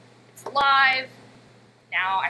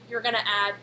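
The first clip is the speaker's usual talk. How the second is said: normal talking voice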